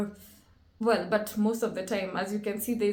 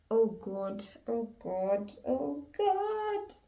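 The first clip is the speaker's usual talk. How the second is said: mounting dread; 5 dB quieter, much slower than usual. Real, not acted. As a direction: repeat it with rising alarm. Watching the car being swept away.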